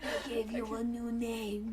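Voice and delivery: ordinary talk, nothing marked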